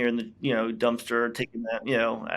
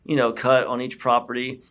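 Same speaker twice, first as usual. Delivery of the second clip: ordinary talk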